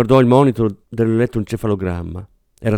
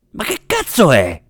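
higher, exasperated outburst; angry yell; 4 semitones higher than usual. A narrator's reading. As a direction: demand it furiously, voice raised. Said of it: "urlò verso Fabio Saletti". (grido esasperato)

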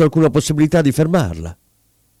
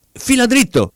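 desperate high-pitched shout; cornered and desperate; 12 semitones higher than usual. A narrator's reading. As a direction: cry out in panicked urgency. Kovalyov still desperate to coachman.

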